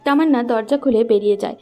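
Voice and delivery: normal talking voice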